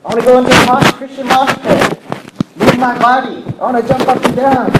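high, excited exclaiming; overflowing joy. Acted. Preacher acting out Elizabeth wanting to jump up and down, elated. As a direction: gush it out high, as if jumping for joy. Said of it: "She was filled with so much joy".

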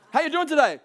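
high, loud mock hello; acted breezy greeting. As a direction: greet someone with exaggerated pep. Acts a cheery everyday greeting.

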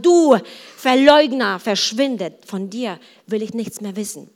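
loud accusing outburst, easing off toward the end; acted harsh dismissal; mock angry rejection. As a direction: cast him out, hitting the first words hard. Imagined rejection of Peter, acted out.

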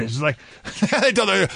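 usual speaking voice

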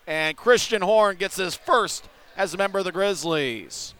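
ordinary delivery

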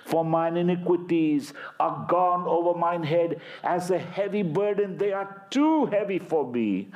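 fervent psalm recitation; impassioned scripture reading. Acted. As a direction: plead the psalm to God. Preacher reads psalm aloud.

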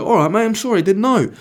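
high, loud whiny apology, put on; mock apology. Acted apology in van quarrel.

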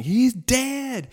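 alarmed nurses. Retold or imitated. Nurses at the station thinking he died, alarmed. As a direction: blurt it in panic.

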